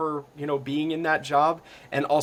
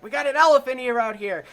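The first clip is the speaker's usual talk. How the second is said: high, raised imitation of a deckhand's call; mock fisherman's shout, pitched way up; 12 semitones higher, 5 dB louder than usual. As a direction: holler it across the deck. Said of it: "they started calling for".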